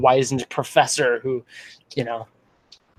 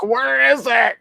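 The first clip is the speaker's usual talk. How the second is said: whiny friend; 9 semitones higher, slower than usual. Retold or imitated. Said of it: "I had friends who were like".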